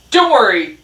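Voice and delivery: loud, gruff shouted retort; shouting. A narrator's reading. Dog answers Rat's warning, shouting. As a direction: shout back across the yard. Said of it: "Dog shouted".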